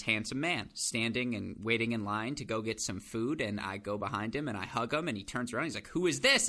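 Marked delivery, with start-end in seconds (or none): 5.9-6.5 a retold startled question, pitched up and louder; startled "who is this". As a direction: play the cousin caught off guard by a hug. Cousin startled by surprise hug.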